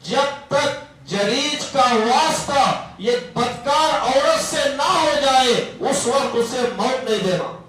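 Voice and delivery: a mother calling down a curse, slow and high; mother's curse. Retold or imitated. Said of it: "کہنے لگی کہ".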